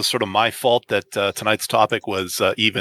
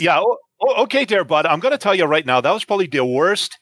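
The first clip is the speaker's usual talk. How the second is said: put-on higher-pitched Canadian hoser accent; mock hoser accent; 6 semitones higher than usual. Co-host scolding host's bad impression, in mock hoser accent. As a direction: answer like an indignant Canadian hoser.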